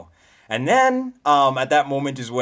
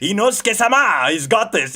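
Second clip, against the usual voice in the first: higher, louder cocky boast; Inosuke's bravado; 8 semitones higher, 7 dB louder than usual. Retold or imitated. Streamer voicing Inosuke rushing in, cocky. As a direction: puff up like a reckless boar fighter. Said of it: "you know?".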